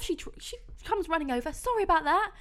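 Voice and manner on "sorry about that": put-on high, light Irish accent; quick Irish lilt, voicing a friend. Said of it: "in her Irish accent".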